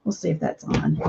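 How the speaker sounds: normal talking voice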